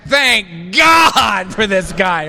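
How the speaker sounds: slow, loud relieved outcry; relieved shout. Retold or imitated. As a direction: cry out in relief. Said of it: "And I just went".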